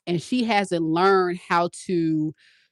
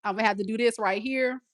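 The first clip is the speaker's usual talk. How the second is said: ordinary delivery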